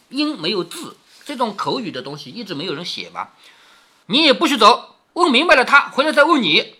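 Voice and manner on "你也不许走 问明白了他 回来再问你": high, loud threatening command; acted angry warning, voice raised; stern order. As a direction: order the servant to stay put. Angry mistress orders servant to stay.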